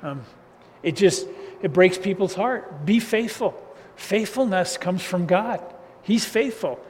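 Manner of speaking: normal talking voice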